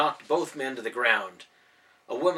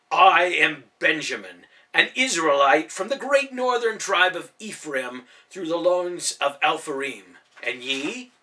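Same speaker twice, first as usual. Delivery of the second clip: grand formal introduction; 3 semitones higher, 7 dB louder than usual. A narrator's reading. Ben formally introducing himself.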